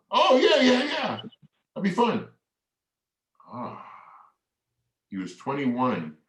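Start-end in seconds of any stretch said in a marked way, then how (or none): 0.1-2.3 put-on high, bright-eyed kid's yes with a laugh; laughing, delighted child's voice; childlike excitement. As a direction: gush like an eager little kid. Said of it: "he responded like the three-year-old again".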